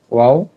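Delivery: quiz-like wow, punched; emphatic repeat. Shown as a demonstration. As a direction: hit wow loud with a rise. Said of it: "এটা কি হলো".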